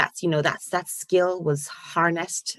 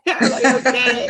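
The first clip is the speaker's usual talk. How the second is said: higher and louder through laughter; laughing okay; 8 semitones higher, 8 dB louder than usual. Real, not acted. Laughing at being overruled.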